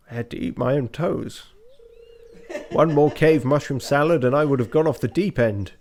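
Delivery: put-on old professor voice. Reads Professor Snail's dialogue.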